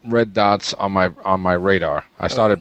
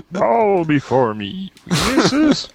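high, slow Kermit-like dark lord; squeaky puppet villain voice; 10 semitones higher, slower than usual. Pretend. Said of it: "make him sound like Kermit the Frog".

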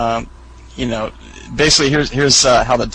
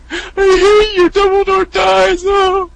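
much higher, louder mock crying wail; high hammed-up wail; 5 dB louder, slower than usual. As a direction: retell the sobbing outburst. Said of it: "It's like".

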